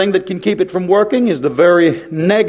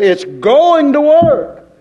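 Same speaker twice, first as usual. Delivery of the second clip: emphatic declaration; 7 semitones higher, much slower than usual. Shown as a demonstration. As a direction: proclaim it with full conviction. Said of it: "I say".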